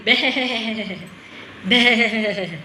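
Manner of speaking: light bleating goat. Shown as a demonstration. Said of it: "They say".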